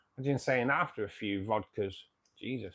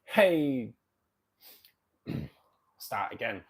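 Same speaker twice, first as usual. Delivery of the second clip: ordinary talk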